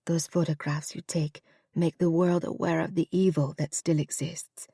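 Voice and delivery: soft insistence trailing into a whisper; a dying mother pressing her point, voice going; frail mother fading to a whisper. Acted. To her son, softly insisting then whispering.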